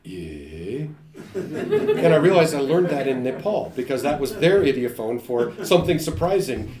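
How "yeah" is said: slow, drawn-out surprised "Yeah". Shown as a demonstration. The wife quoting his odd surprised "Yeah". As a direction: stretch "Yeah" slowly as a gasp of surprise. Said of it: "every time you were surprised, you said".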